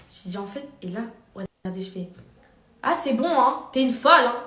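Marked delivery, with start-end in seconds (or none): 2.8-4.4 loud, scornful put-down; raised retort. Retold or imitated. Vlogger retells calling the stranger crazy, loud and scornful. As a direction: dismiss her loudly as crazy. She retells insulting the woman.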